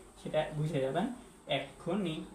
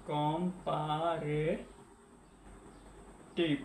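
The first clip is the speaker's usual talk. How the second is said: Comparative very slowly, syllable by syllable, softly; drawn-out while writing; 6 dB quieter, much slower than usual. Real, not acted. Teacher writing the note, comparative drawn out softly by syllable. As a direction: stretch each syllable of comparative quietly.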